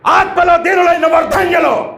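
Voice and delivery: high, loud shouted proclamation; a misplaced shout from the hilltop; shouted blessing. Shown as a demonstration. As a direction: bellow the blessing at the crowd. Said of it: "అని ఉంటాడా?".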